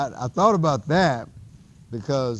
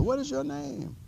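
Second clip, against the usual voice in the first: much higher, calling across the valley; imitating Wild Bill; 5 dB quieter than usual. Wild Bill calls across the valley to the chief, high mock shout.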